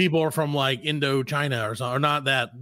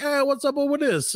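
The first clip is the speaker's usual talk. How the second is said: high mock Italian "eh"; hammed-up Italian guy; Italian accent; 12 semitones higher than usual. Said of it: "They're like".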